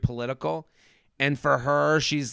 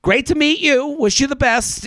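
high bright farewell, mock cheerful; mock-cheerful goodbye, pitched up; breezy brush-off; 8 semitones higher, 3 dB louder than usual. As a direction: wave her off with fake cheer. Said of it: "if I'm Zach, I'm going".

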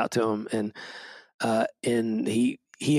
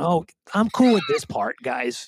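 a lightly voiced, breezy God, higher and louder; casual God; 4 semitones higher, 3 dB louder than usual. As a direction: toss it off like an unbothered God. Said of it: "God's just sitting there going".

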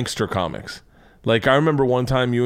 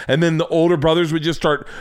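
ordinary talk, nothing marked

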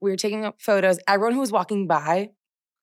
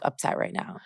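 hushed upset; 13 semitones lower, 8 dB quieter than usual. Retold or imitated. Quoting friend's upset confession.

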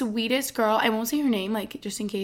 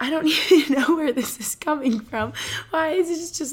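real laugh breaking into higher words; flustered laughing; 6 semitones higher, 5 dB louder than usual. Real, not acted. Podcaster baffled by where this came from, flustered laughing. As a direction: laugh at your own emotional tangent. Embarrassed by her sudden emotional tangent.

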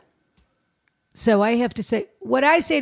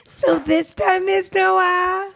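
high, drawn-out mock echo ending on a long "ah"; 8 semitones higher, much slower than usual. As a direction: parrot it back slowly, high-pitched, stretching "ah". Mockingly echoes the R question.

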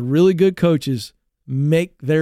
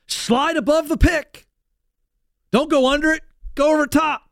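high-pitched imitated coach's instructions; coach correcting; 9 semitones higher than usual. Coach to player on the court, urgent acted correction. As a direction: call corrections out to a player mid-game. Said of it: "That's a quick in-game correction".

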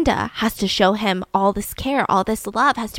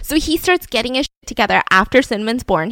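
normal talking voice

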